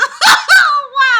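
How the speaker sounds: high, loud cackle; screaming laugh; shrieking laugh. Real, not acted. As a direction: burst out laughing. Bursting laugh at the text.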